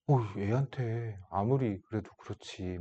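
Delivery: slow, hushed inner disapproval; 속으로 하는 생각. Retold or imitated. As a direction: murmur it softly, as a private thought. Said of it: "막 이런 생각이 들었다". (나직하고 느리게 혼잣말하듯)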